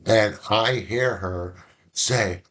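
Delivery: ordinary delivery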